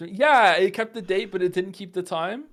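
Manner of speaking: loud exasperated outburst. Real, not acted. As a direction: vent the frustration loudly. Exasperated rant.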